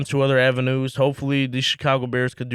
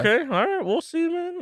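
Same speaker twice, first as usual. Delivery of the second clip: high squeak. Real, not acted. Doubtful reply to the prediction.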